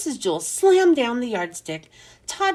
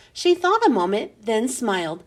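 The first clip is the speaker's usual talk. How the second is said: usual speaking voice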